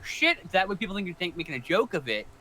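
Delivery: normal talking voice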